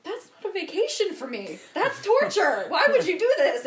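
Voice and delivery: high-pitched, loud mock outrage with laughter; appalled protest. Retelling her appalled objection.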